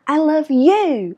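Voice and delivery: "you" loud, high and drawn out; slow down and hit "you"; stress on YOU. Shown as a demonstration. Teacher showing the word she means, stress on YOU. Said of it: "cái từ you là cái từ mình muốn nhấn".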